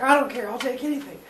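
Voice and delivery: high, fast, desperate begging; desperate plea. Acted. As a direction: rush it out, desperate for relief. Desperate Zeus begs.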